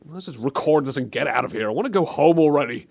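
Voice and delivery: ordinary talk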